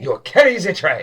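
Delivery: a hint of a stern chef, repeated firmly; insistent chef. Head chef kobold repeating the order, lightly stern. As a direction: repeat the order to drive it home.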